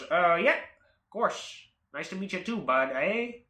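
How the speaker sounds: put-on Maine accent with lilting rises. Car owner meeting the buyer, in a Maine accent. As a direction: do a folksy Mainer imitation. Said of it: "he still has a Maine accent".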